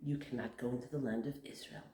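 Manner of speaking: quick stern whisper of judgment; hushed whisper. Retold or imitated. God whispering the verdict to Moshe. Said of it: "And Hashem says".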